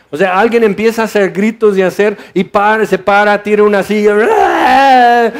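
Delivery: half-laughing retelling of a chair-throwing scene; hammed-up excitement; animated. Acting out a disruptive outburst.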